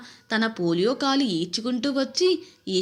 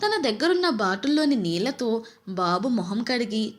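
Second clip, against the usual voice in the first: usual speaking voice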